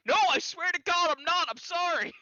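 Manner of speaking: high, pleading denial; frantic apology. Acted. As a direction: plead innocence in a high, loud voice. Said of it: "and they're like, "Are you making fun of my accent?".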